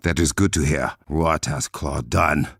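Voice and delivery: ordinary talk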